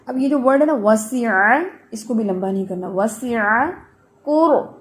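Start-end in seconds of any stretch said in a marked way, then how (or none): none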